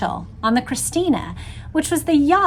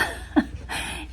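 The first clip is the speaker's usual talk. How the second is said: soft laugh; 10 dB quieter than usual. Real, not acted. Laughing at a crazy story.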